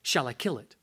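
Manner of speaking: low, quiet, unhurried question; stern angel voice. Acted. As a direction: ask it low and grave.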